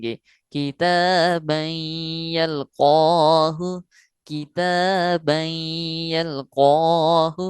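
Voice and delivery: کتابا یلقاہ very slow, vowels stretched two counts; drawn-out recitation. Shown as a demonstration. Teacher demonstrating to students: کتابا یلقاہ slow with stretched vowels. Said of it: "دو حرکات کے برابر اس کو کھینچنا".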